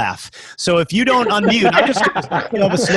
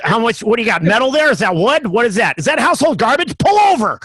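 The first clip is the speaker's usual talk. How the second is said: rapid-fire interrogation, rising higher and louder up to a yelled "Pull over!"; barking gatekeeper; 6 semitones higher, 3 dB louder than usual. Retold or imitated. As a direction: grill them like a self-important cop, building to a yell. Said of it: "Timothy has rules".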